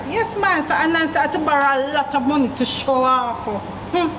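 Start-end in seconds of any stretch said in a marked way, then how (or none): none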